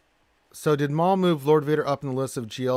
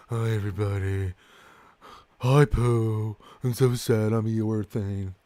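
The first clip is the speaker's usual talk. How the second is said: mock gloom; slower than usual. Co-host mocks a lifeless introduction.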